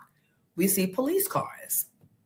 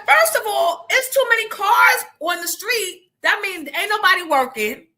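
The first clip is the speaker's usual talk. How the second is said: voicing her son; 10 semitones higher, 5 dB louder than usual. Son's complaint voiced high.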